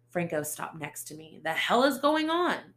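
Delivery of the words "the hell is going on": high, loud demand; detective bursting in on the scene, loud; exasperated demand. Acted. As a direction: insist on answers, loud.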